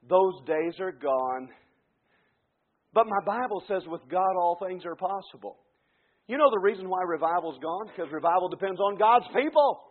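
ordinary delivery

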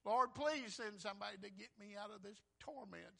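acted tearful appeal; crying plea. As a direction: beg urgently as the damned. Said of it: "they're crying".

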